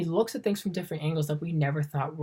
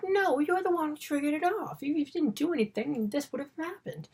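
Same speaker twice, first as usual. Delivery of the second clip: much higher mock-protest voice; high-pitched protest; 12 semitones higher than usual. Accused men blaming the agent who triggered it, high mock protest. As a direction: throw the blame back in a high voice.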